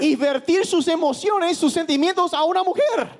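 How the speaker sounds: match the preacher's fire; predicación enérgica en voz alta; impassioned preaching. Acted. Interpreter matches preaching force.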